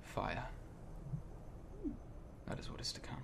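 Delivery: hushed prophecy sinking to a whisper; ominous Akros. Acted. Akros answering what is to come, ominous and fading to a whisper.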